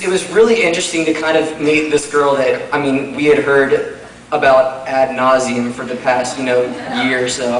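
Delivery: usual speaking voice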